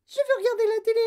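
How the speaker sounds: tiny, extremely high squeal; shrieky little mouse-sized voice; yelping tantrum. Acted. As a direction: squeak it like a furious miniature boy. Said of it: "glapit-il".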